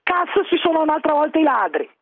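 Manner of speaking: normal talking voice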